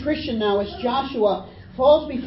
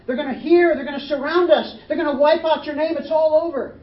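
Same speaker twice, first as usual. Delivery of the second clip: higher, louder rush of dread; panicked Joshua; 6 semitones higher, 5 dB louder than usual. Retold or imitated. Joshua fearing the nations will wipe them out, panicked. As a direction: spiral into worst-case panic. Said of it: "he posits the worst case scenario".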